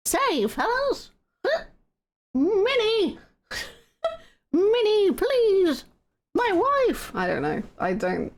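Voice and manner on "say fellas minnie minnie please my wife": much higher cartoon mouse voice; Mickey Mouse voice, high falsetto; Mickey Mouse impression. Shown as a demonstration. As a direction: chirp it like Mickey. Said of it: "What does Mickey Mouse say?".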